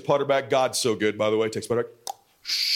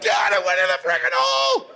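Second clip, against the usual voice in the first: put-on higher shouted boy's amazement; excited kid imitation; 4 semitones higher than usual. Son telling dad it went in, excited shout. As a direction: mimic a thrilled boy hollering.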